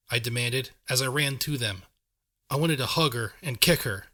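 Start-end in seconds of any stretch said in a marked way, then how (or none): none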